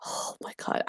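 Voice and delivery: breathy amazed whisper. Real, not acted. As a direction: whisper it in awe. Surprised nostalgia, whispered.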